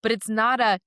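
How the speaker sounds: ordinary talk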